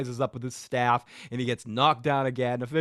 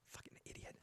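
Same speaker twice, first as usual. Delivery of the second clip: whispered aside; 30 dB quieter than usual. Real, not acted. Muttered insult aside.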